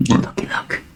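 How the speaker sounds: hushed, eager whisper; urgent whisper. Real, not acted. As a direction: whisper it so he stays asleep. Whispering about sleeping pet.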